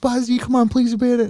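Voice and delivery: high, coaxing group-of-friends plea; pleading. Retold or imitated. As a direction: imitate friends begging him to join. Acts out girlfriends begging uncle.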